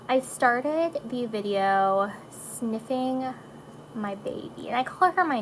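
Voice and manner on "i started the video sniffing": ordinary talk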